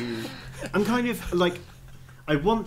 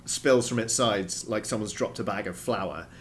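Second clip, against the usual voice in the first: ordinary delivery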